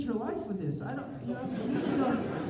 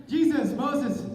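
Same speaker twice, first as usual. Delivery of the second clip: loud, higher excited call; rally heaven for the show; God calling friends; 5 semitones higher, 4 dB louder than usual. Retold or imitated. God calls Jesus and Moses over, loud and excited. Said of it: "God was up in heaven like".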